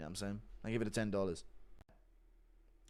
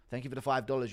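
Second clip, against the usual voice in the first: usual speaking voice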